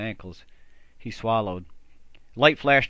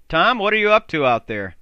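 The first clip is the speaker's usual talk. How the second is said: calling out; 8 semitones higher, 9 dB louder than usual. Acted. Andy calls from other tent.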